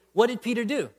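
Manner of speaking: usual speaking voice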